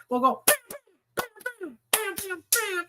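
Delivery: high punchy bam bam noises; bam sound effect. Pretend. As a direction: beat out each bam like a drum. Hype about hot girls.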